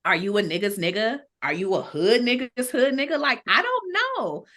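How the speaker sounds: ordinary delivery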